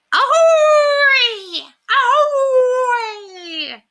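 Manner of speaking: very high, loud, wailing squawk; howling parrot. A narrator's reading. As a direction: howl it like a wolfish bird. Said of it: "Was this talented avian of the stage howling?".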